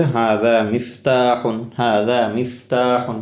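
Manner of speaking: spelled out slowly for students; teacher models the Arabic answer, slow and clear. Shown as a demonstration. Arabic teacher drilling students on the answer, spoken slowly. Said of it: "উত্তর কি হবে?".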